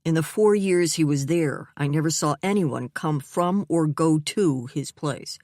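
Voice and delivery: usual speaking voice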